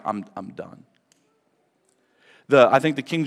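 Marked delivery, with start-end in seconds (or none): 0.0-0.8 much softer, crushed and hopeless; defeated. Retold or imitated. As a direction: let it collapse, utterly undone. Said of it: "he's like".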